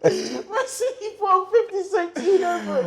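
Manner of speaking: high-pitched, breaking into laughter; real helpless amusement; laughing interruption. Real, not acted. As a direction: cut in while cracking up. Interrupts, cracking up at the story.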